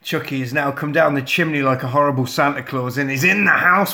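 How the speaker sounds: usual speaking voice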